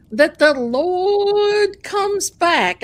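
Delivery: high, loud, drawn-out Southern preaching; mock Southern preacher. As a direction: stretch LORD out in a hammed-up Southern drawl. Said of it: "he's got this Southern accent".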